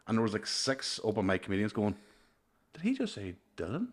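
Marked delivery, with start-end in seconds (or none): 2.7-3.9 higher, softer astonished question; hushed disbelief. Retold or imitated. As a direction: imitate stunned comedians asking under their breath. Comedians reacting to a famous name.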